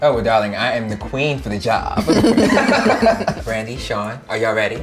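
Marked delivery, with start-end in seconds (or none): 2.1-3.4 high diva laugh. Real, not acted. Host laughs at own joke.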